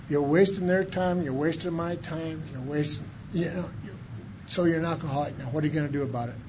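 Nick's words echoing in his head; remembered voice. Retold or imitated. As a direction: let it fade like a memory.

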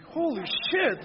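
very high, stunned exclaim. Retold or imitated. Reading the Snapple lid, a very high stunned exclaim. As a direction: gasp it out in disbelief. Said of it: "I'm like".

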